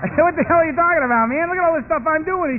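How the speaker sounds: loud, high-pitched objection; high, exasperated outburst; indignant protest. Acted. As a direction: argue back, offended and worked up. Acts out himself protesting to old timer.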